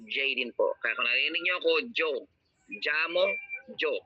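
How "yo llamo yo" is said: "Jo" and "Jamo" begun with an English J; J sound. Shown as a demonstration. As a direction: say "Jo", "Jamo" with a hard J. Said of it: "can sound like J". ("Jo" at "Jamo", binibigkas na may tunog na J)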